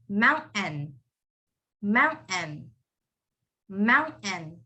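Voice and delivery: American "mountain", moun-en with silent T; American accent. Shown as a demonstration. Teacher shows students the American accent on mountain. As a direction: break "mountain" before a separate "en", American style. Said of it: "But in an American accent, you would say it".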